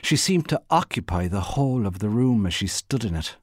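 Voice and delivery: ordinary delivery, nothing marked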